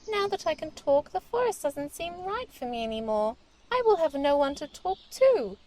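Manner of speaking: the mouse confides his loneliness to the princess, lightly voiced and a bit sad. Mouse explains why he is sad.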